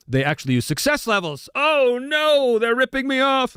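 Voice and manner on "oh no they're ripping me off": high, hammed-up cry of dismay; mock outrage. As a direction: pretend to be scandalised, voice pitched way up. Mock outrage at shared game mechanic.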